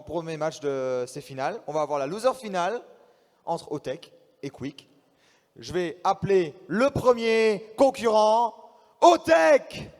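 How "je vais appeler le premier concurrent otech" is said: ring announcer. Acted. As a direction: build up and shout the name, high. Announces the first player.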